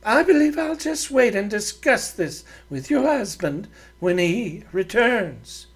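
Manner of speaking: a proud woman giving orders; haughty lady voice. Acted. Doreen threatens to wait.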